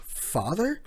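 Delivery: high, startled question; shocked. Retold or imitated. Stunned Uruchi learns who her father was, startled. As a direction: gasp out the word in disbelief. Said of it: "Uruchi looks shocked and scared".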